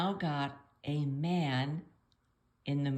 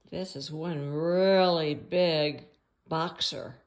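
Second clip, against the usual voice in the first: big-sounding words; 6 dB louder than usual. Shown as a demonstration. Describing the big boxer in the picture, slow and weighty. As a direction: stretch and swell "really big" to sound huge.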